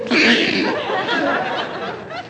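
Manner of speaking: high-pitched delighted giggle. Real, not acted. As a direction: giggle at the fooled crowd. Watching fooled crowd.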